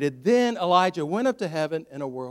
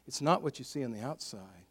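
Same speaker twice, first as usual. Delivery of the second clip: ordinary talk, nothing marked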